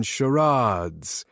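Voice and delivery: "charades" with a long, drawn-out ah in the middle; weird pronunciation. A narrator's reading. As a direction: stretch the middle of "charades" into "shuh-RAAHDS". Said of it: "he said charades really weird, with a long, drawn-out ah in the middle".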